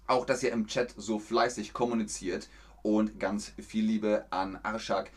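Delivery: ordinary delivery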